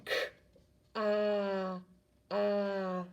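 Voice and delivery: "K-ah, ah" stretched and split apart; over enunciating. Shown as a demonstration. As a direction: segment "K-ah" slowly. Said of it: "stretch out car".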